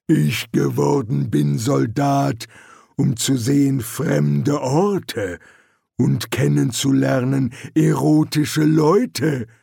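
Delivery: ponderous troll, forceful and monotone; lumbering troll recital. A narrator's reading. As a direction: speak as a troll, heavy word by word. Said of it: "bestätigte der Troll".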